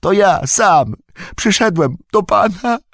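high, loud voice choked with tears; overwhelmed with relief, near sobbing; tearful. A narrator's reading. Sam reassuring Frodo he has come, tearful cry. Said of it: "nie widząc prawie nic przez łzy".

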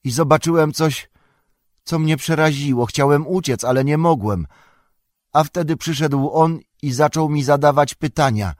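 high-pitched, halting, frightened account; distressed hobbit faltering as he confesses; stammering confession. A narrator's reading. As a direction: get it out in anxious bursts. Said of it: "wyjąkał Pippin".

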